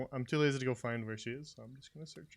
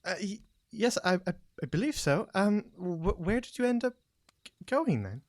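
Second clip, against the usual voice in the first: higher-pitched, stammering reply; haltingly, pitched up; hesitant character; 7 semitones higher than usual. Acted. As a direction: play a tentative, polite townswoman. Game master plays a character.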